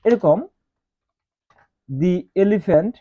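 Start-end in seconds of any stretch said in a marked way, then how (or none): 1.9-2.9 "the elephant" spelled out very slowly as "dee elephant"; slow teaching demo of "dee elephant". Shown as a demonstration. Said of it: "দি উচ্চারণ".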